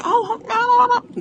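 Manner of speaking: modestly brushing it off; flattered banker. Retold or imitated. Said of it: "They're gonna say".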